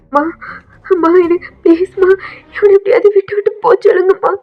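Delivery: high, fast, desperate plea; panicked pleading. Acted. Daughter begs mother to send him away, panicked and fast. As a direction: rush the words out begging.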